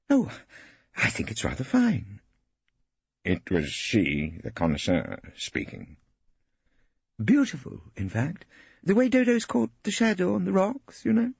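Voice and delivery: high, affected art lover; Hilda as connoisseur. A narrator's reading. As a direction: pronounce judgement grandly.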